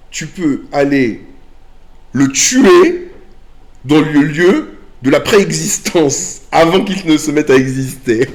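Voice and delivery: getting carried away with excitement; excited. Real, not acted. Excited climax about killing robot.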